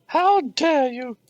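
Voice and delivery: offended gasp. Acted. Voicing an offended character.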